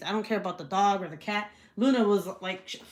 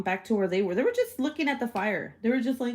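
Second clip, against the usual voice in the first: normal talking voice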